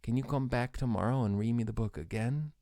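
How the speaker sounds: hint of a child. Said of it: "the kid goes".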